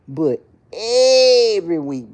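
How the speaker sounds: savoring delight. Real, not acted. Savoring weekly pay.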